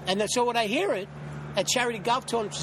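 ordinary talk

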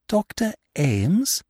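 high, slow, rising disbelief; stunned question; astonished, drawn-out query; stupefied. A narrator's reading. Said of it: "I cried, stupefied".

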